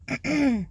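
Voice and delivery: clearing a tired throat. Real, not acted. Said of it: "I don't know if I can keep reading".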